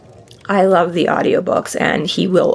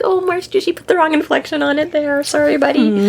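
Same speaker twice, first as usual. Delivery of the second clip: higher, apologetic mock address; mock sympathy; 8 semitones higher than usual. Addressing Marsters about wrong inflection, mock apologetic. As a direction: gently chide in a raised voice.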